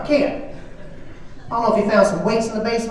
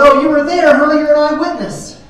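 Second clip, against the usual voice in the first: sarcastic, louder and higher; mocking wife; 7 semitones higher, 7 dB louder than usual. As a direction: throw his words back with mock disbelief. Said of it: "And she goes".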